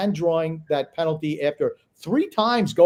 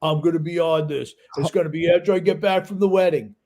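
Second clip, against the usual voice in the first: mock tyrant. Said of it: "being the tyrannical bastard that he is, said".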